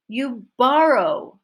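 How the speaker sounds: borrow loud, high and slow; stress on BORROW. Shown as a demonstration. Teacher corrects the wrong statement, loud stress on borrow. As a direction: hit borrow hard and slow. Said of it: "my stress is now on borrow".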